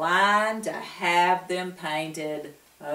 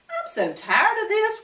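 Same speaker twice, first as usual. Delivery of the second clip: high-pitched weary whine; whiny moan; 14 semitones higher than usual. A narrator's reading. Woody seeing purple nose, high whiny moan. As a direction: moan it out, fed up. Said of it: "Woody moaned".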